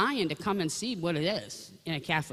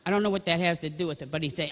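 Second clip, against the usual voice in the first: usual speaking voice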